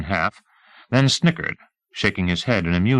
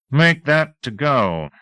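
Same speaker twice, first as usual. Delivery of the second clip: slow commanding interruption; 7 semitones higher, 3 dB louder, slower than usual. A narrator's reading. Teasel cuts in, slow.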